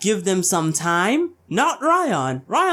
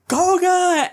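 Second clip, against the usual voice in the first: sharp high call of a name; 9 semitones higher, 3 dB louder than usual. Retold or imitated. Ryuki shouting to his knocked-out friend Koga, alarmed call.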